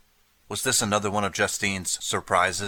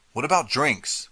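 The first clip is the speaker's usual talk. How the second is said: interrupting; 6 semitones higher than usual. A narrator's reading. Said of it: "interrupted Marty".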